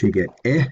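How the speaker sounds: put-on Old English short e, clipped eh; short e sound. Shown as a demonstration. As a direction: say eh as a short open e. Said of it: "if you pronounce the letter E in Old English".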